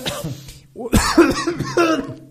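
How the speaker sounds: sudden choking cough. Real, not acted. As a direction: cough as if a drink went down the wrong way. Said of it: "ich hab mich grad verschluckt".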